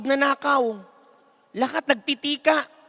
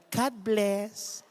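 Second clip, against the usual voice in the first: much softer, lower, saintly; serene blessing; 3 semitones lower, 9 dB quieter than usual. Acted. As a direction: bless them gently, unbothered. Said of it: "Sabi niya".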